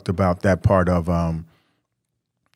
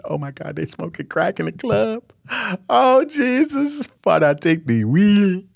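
high, slow, squealing alarm in mock horror; mock shocked shriek; 11 semitones higher, slower than usual. Widower acting shocked at club hookah smoke, high squeal.

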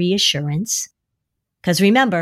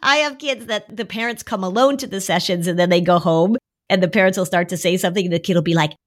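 ordinary talk

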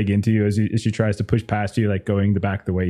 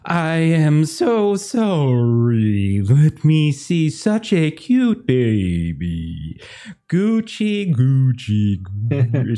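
put-on higher, slow, gushing baby talk; cooing receptionist; 4 semitones higher, much slower than usual. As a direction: coo over the baby to cover the shock.

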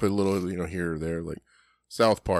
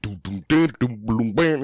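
vocal bass riff; bass line imitation. Imitating Token's bass line with his voice. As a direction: mouth the bass line, badass. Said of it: "he's like".

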